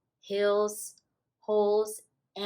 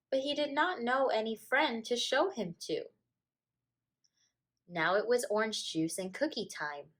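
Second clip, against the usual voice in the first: plain reading voice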